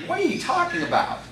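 high-pitched mock-baffled objection; baffled protest. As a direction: imitate listeners arguing back, pitch leaping up. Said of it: "They're basically arguing with him".

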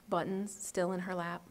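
usual speaking voice

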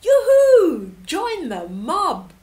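high, loud yoo-hoo call; delighted shout. Acted. Four animals call the stork to join them. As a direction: hail the stork joyfully. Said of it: "the other four shouted".

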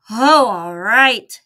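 slow, loud grumble; a sleepy man giving in, annoyed; grumbled. Acted. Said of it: "he grumbled".

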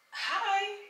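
usual speaking voice

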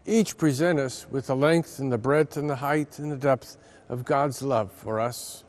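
ordinary talk

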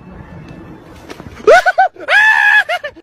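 loud high shrieking laugh; howling laugh. Real, not acted. Prankster howling at his own joke, shrieking laugh. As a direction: burst into a wild cackle.

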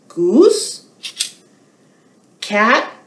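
ordinary delivery

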